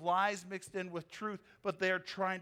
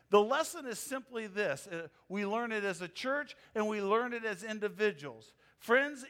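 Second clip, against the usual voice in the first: ordinary delivery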